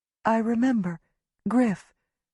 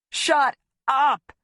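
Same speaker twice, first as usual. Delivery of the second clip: bellow; 7 semitones higher, 3 dB louder than usual. A narrator's reading. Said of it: "he bellows".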